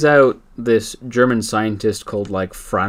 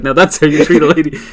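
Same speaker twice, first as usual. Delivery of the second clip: laughing mock hero; 7 semitones higher, 10 dB louder than usual. Aquaman punchline, laughing.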